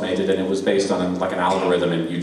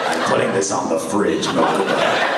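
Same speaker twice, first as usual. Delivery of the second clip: lower, mock-stern character voice; deadpan low cartoon role; Kaiba; 4 semitones lower than usual. Kaiba showing off a drawing to his brother, low character voice. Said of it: "Kaiba drew a picture of".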